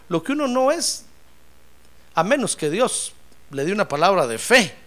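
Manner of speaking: usual speaking voice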